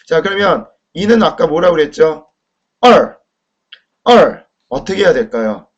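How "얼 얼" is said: 얼 loud, falling sharply from high; fourth-tone demo. Shown as a demonstration. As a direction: drop 얼 steeply in Mandarin fourth tone. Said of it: "사 성이네요".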